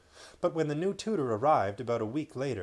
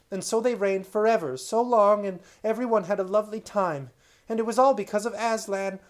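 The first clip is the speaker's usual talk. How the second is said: put-on high, bright-eyed rush of a boy telling a story; excited child; 8 semitones higher, 6 dB louder than usual. Boy Caspian excitedly telling his uncle about Narnia. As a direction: gush it out like a thrilled child.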